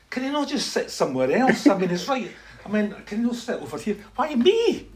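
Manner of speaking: exasperated high-pitched complaint; put-upon whine, retold for laughs; grumbling protest. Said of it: "Stop your grumbling".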